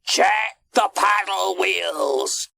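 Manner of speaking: high, drawn-out shout; calling out orders. A narrator's reading. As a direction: holler an order down the deck. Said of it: "called out his orders".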